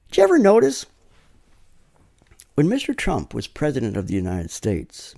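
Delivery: usual speaking voice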